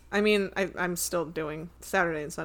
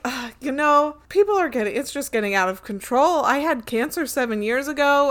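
higher, louder mock complaining; exasperated customer imitation; 5 semitones higher, 5 dB louder than usual. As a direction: whine it up high, imitating a fed-up customer. Acting out a dismissive customer's complaint.

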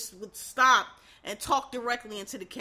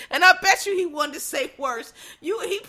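ordinary talk

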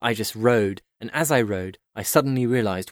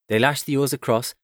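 ordinary delivery, nothing marked

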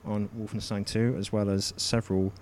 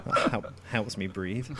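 ordinary delivery

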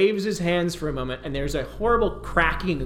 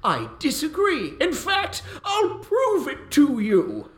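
a much higher, grandly boastful lord; pompous mage; 11 semitones higher, 3 dB louder than usual. Acted. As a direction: boom out like a vain wizard. Plays Burzin the mage.

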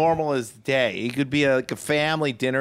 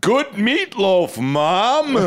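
slow, higher, overly polite thanks; fake sober son; 8 semitones higher, 3 dB louder, much slower than usual. High son complimenting mom's dinner, mock innocent. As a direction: act straight at the dinner table. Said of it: "he'd be like".

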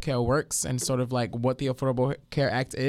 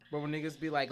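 ordinary talk

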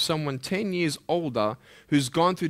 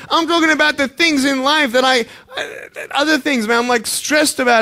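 real distress; 7 semitones higher, 5 dB louder than usual. Real, not acted. Admitting what stresses him.

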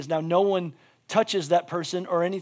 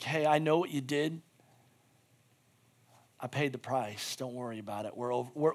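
soft, lowered voice of forgiveness; gentle reassurance; 5 semitones lower, 9 dB quieter than usual. Acted. Preacher voices God forgiving him, gentle reassurance. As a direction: reassure tenderly, dropping very quiet and low.

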